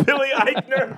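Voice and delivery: high, laughing name repeat; laughing disbelief. Real, not acted. Laughing at surprising casting news.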